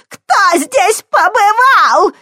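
put-on high, slow, shrieking old woman; hysterical scream. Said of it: "истерично закричала она". (истеричный крик старухи)

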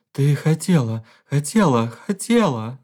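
the husband accuses his wife through tears; hurt accusation. A narrator's reading. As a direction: throw it back at her, wounded.

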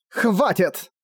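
high, short command without much volume; angry cry. A narrator's reading. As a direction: cut off his pleading sharply. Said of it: "крикнула Зена". (сердитый окрик, высоко)

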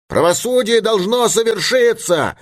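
high, emphatic declaration; crowd demand. A narrator's reading. Someone in angry crowd demands justice. (требовательный выкрик из толпы, высоко)

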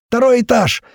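high, loud alarm shout; frantic yelling. A narrator's reading. Madman raising the alarm, high loud yell. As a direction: yell it out for the whole building to hear. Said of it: "продолжал орать тот псих".

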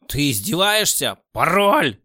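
loud furious yell; angry shout. A narrator's reading. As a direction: bark the demand. Boss demands password, furious.

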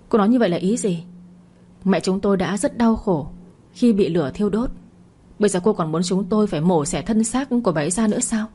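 ordinary delivery, nothing marked

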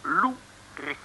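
langzaam en hoger, gewichtig gebroken Nederlands; rare mandarijnstem. Acted. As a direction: zet de gekke mandarijn neer. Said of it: "Kerel, wat spreek je gek?". (Loeris praat gek tegen Makke)

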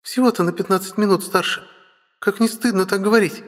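put-on high, lively young girl; playful little sister voice.

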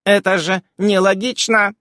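high, loud protest; wailing. A narrator's reading. Footnote wailing at the illogic, high and loud. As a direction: wail it in protest. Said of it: "завопила сноска".

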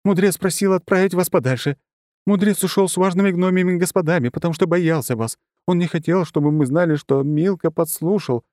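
high, hurried, scared confession; frightened babbling. A narrator's reading. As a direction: blurt it all out in fear. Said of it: "тут же зачастила бледная, как моль".

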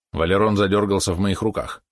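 ordinary delivery, nothing marked

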